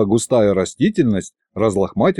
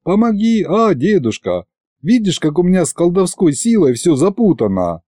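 higher-pitched pitiful begging; plaintive plea; 7 semitones higher than usual. A narrator's reading. Narrator begs grandpa sorcerer to fix his magic, plaintively. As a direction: beg pitifully, pitch lifted. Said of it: "протянул я жалостливо".

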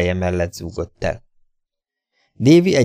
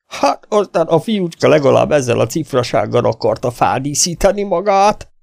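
mocking bully; 8 semitones higher than usual. Davy keeps mocking the stranger.

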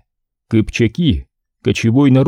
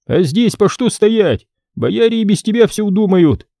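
put-on dismissive young boyar; 9 semitones higher than usual. Ivan dismisses the prince.

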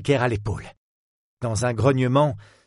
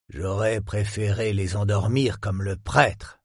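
calmly menacing villain, slightly lowered; lightly voiced villain. A narrator's reading. The antique dealer lectures the cornered young woman, cold and menacing.

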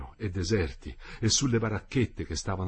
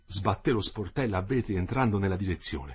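ordinary delivery, nothing marked

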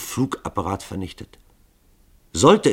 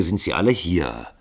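usual speaking voice

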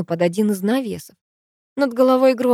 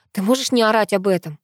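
ordinary delivery

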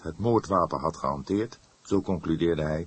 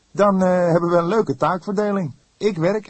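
much higher, louder voice with a teasing grin; grinning young detective; 13 semitones higher, 9 dB louder than usual. A narrator's reading. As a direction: needle the boss cheerfully. Fledder grinning at his colleague. (Fledder grijnzend, hoog en luid plagerig)

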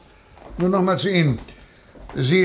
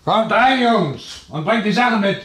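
calling out; 7 semitones higher than usual. Acted. Inspector calls men from outside.

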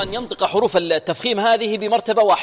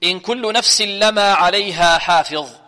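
slow, measured tajweed chant; Quran recitation; 4 dB louder, slower than usual. Shown as a demonstration. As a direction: recite with long held vowels and careful letters. Teacher recites the verse as model.

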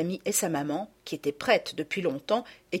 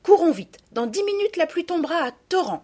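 pressée; 9 semitones higher, 4 dB louder than usual. Acted. Mère presse les enfants sous l'orage.

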